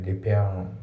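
"リペアアン" run together as English "repair-an"; joined "リペアアン" with no D; linking. Shown as a demonstration. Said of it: "リペアアンドのところがリンキングで".

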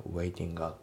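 "ウェイティングア" joined as "waitinga"; quick, fused "ウェイティングア"; linked pronunciation. Shown as a demonstration. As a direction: slide the NG straight into A in "ウェイティングア". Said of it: "リンキングで".

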